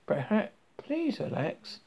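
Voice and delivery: slow, high, pleasant stewardess. A narrator's reading. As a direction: soothe slowly like an airline stewardess. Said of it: "like a stewardess on an airline".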